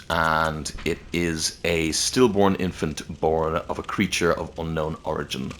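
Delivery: normal talking voice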